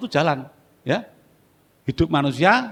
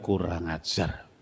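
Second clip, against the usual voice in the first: said inwardly; 11 semitones lower, 9 dB quieter, much slower than usual. Retold or imitated. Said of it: "Dalam hati saya bilang".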